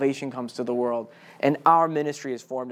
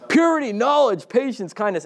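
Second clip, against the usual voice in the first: high, punchy mock-promotional list; hammed-up reading; 7 semitones higher, 5 dB louder than usual. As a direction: pitch the list high like an over-keen advert. Mock brochure reading carries on.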